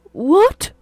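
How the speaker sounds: high, startled what; husband stunned by his diagnosis. A narrator's reading. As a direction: gasp in disbelief.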